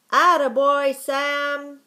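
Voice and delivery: high, very loud cheer; boys' shout. A narrator's reading. Boys cheering on the digging dog, shouting. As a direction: shout praise to spur him on. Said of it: "shouted the boys".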